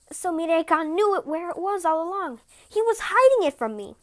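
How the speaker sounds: high, loud, indignant; indignant realisation. Acted. Tanabata realising her husband hid her robe, indignant and loud. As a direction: let the hurt spill into anger.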